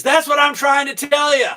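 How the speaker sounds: high, loud punchline yell; exasperated shout. Acted. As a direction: blurt it out, at the end of his rope.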